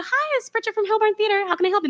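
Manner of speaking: high, bright receptionist phone voice; phone greeting. Retold or imitated. Box office worker answering patron calls, perky phone voice. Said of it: "I would pick up the phone and go".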